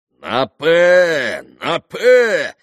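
loud, high repeated bet. A narrator's reading. Mitya doubling stakes. (громкие азартные выкрики)

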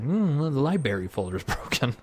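offhand mock diagnosis; imitated coworker. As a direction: casually imitate the coworker's verdict, quietly. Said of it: "He did it every single customer".

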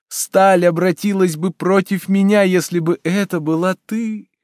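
high, fervent, drawn-out pleading; impassioned lover. A narrator's reading. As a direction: plead adoringly, pitch held high.

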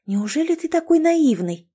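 put-on high, incredulous girl's question; sincere surprise. A narrator's reading. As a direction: ask it in genuine astonishment. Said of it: "искренне удивилась девушка".

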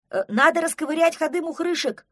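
high, loud call; shouting back. A narrator's reading. Простодурсон answers Кавриксон from the burrows, shouting back. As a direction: holler the answer across a slope. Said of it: "крикнул в ответ Простодурсон".

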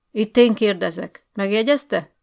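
loud, hard rebuke; stern rebuke. Acted. Officer rebuking the headmaster, loud stern. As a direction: put him in his place.

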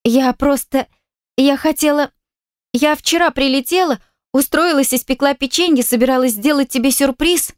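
high, flustered babble; babbling. A narrator's reading. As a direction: stumble out the words, high and nervous. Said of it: "принялась лепетать".

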